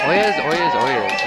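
loud, ringing town crier cry; town crier call. Acted. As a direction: call it out across the square. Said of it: "This is the lead town crier".

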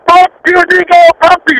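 high-pitched jeering yell, retold with a laugh; mock yell. Rock musician heckling the drummer from above, mock yell. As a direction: yell it down from the scaffolding as a prank. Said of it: "yelling down at him and shit".

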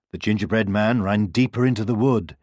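ordinary delivery

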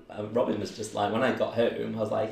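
ordinary delivery

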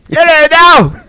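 hammed-up English accent. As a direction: put on an over-the-top English accent for a laugh. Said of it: "in an English voice".

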